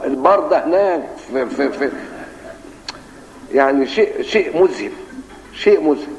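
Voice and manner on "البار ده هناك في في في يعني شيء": ordinary talk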